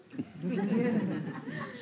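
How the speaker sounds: short amused laugh from the chest; a quick genuine chuckle; real laugh. Real, not acted. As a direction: laugh along at the memory. Speaker laughs at own story.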